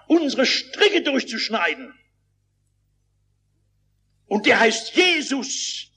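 high, measured preaching voice; declamatory quote of a missionary; solemn proclamation. Retold or imitated. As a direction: announce salvation with deliberate weight.

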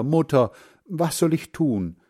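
put-on helpless child's question; pleading boy. A narrator's reading. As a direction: call her name, then plead for advice. Boy asks mother's picture for help.

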